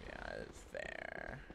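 very low, soft, slow concession; drawn-out low muttered agreement; silly put-on voice. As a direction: sink to a deep, quiet goofy voice. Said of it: "I don't know what voice this is".

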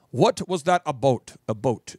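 "about" said like "a boat"; Minnesota vowel. Shown as a demonstration. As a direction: slowly round "about" into "a boat". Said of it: "it's, it's a boat".

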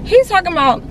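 normal talking voice

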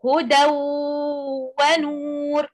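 هدى ونور joined, tanween merged into the و and nasalized; idgham with ghunna. Shown as a demonstration. Teacher modelling the idgham rule for students, nasalized merge. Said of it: "So we say".

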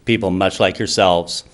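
ordinary talk

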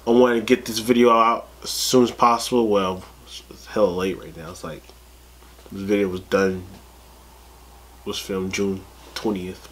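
ordinary delivery

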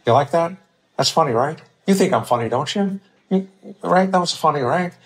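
high, needy questioning voice; insecure stockbroker. Acted. Stockbroker fishing for laughs from writer friend, insecure needy voice. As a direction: beg for approval, each tag rising. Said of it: "he would talk like this".

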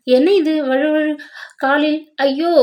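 mild alarm as the foot slips; mild narrated cry. A narrator's reading. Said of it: "உரத்த குரலில் அலறிவிட்டாள்".

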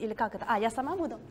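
usual speaking voice

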